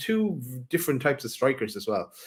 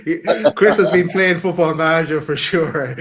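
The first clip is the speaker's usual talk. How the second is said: amused, raised voice breaking into laughter; laughing; 5 semitones higher, 7 dB louder than usual. Real, not acted. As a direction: tease through real laughter, louder and higher. Banter, laughing at co-host's remark.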